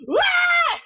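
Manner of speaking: very high mock shriek of scared kids; mock kids' scream. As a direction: shriek like a startled child, hammed up. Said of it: "And we'd be like".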